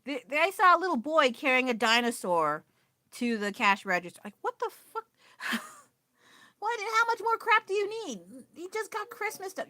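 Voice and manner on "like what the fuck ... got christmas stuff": high, outraged what-the; shocked disbelief. Real, not acted. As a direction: squeal the words up in pure disbelief. Outraged at boy buying dinosaur.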